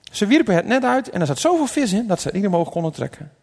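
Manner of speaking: ordinary talk, nothing marked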